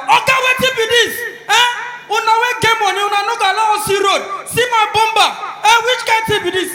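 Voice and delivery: furious poor driver yelling at rich man; angry complaint. Retold or imitated. Poor driver confronting rich man over bumper, angry shout. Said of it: "the guy come down".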